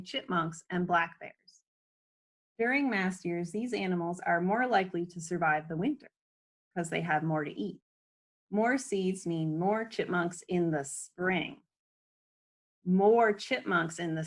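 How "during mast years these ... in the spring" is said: usual speaking voice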